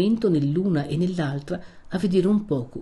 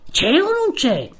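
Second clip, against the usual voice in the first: high, loud, drawn-out question; exasperated pressing, pitched right up; insistent demand; 10 semitones higher, 5 dB louder, slower than usual. Acted. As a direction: demand a straight yes or no. Young man demands a straight answer.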